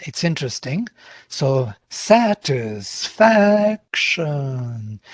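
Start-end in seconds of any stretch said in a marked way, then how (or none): 1.9-5.0 "satisfaction" stretched very slowly, syllable by syllable; very slow pitch demonstration on "satisfaction"; raised then falling pitch. Shown as a demonstration. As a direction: draw out "satisfaction" so the rise and fall shows. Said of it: "once you raise the voice".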